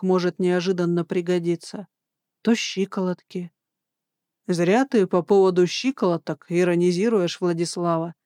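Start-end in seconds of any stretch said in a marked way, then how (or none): none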